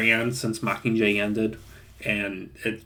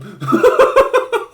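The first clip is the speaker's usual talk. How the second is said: a burst of real, high laughter; loud high laugh; 6 dB louder than usual. Real, not acted. Laughing at the shaky cam.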